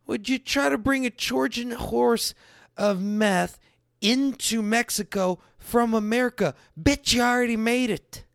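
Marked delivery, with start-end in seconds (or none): none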